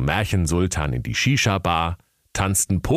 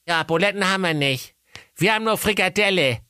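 mother's voice; 12 semitones higher, faster than usual. A narrator's reading. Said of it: "und sagte".

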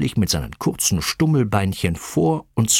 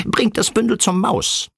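very high, squawky cockerel voice; high-pitched farmyard squawk; cackling rooster; 12 semitones higher than usual. A narrator's reading. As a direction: cluck it out like an agitated rooster. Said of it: "gackerte Ken der Gockel".

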